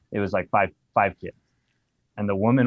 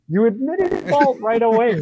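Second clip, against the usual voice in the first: incredulous laugh; 14 semitones higher, 6 dB louder, slower than usual. Real, not acted. Incredulous at the review.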